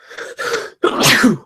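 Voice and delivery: another sharp sneeze; sneezing. Real, not acted. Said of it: "There we go again".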